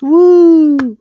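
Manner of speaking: loud, high whooping cheer; mock cheer. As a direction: whoop like a rally crowd.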